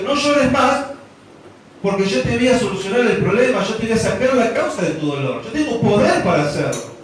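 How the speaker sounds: high, loud, confident "No llores más" that promises a fix; Jesus assuring the widow with authority; commanding comfort. Acted. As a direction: tell her to stop crying like one who has the power to end it. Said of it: "este "no llores" de Jesús es distinto".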